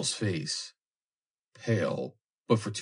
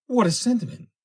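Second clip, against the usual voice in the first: very high, loud exclamation; girl's exclamation; 6 dB louder than usual. A narrator's reading. Girl reacting to Foster's sentiment, crying out. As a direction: cry it out, pitched right up. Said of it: "cried the girl".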